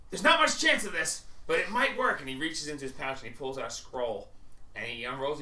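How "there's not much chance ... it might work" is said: high, loud, urgent character voice; Max. Acted. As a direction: offer a desperate long shot. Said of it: "he goes".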